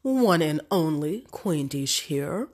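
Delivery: ordinary delivery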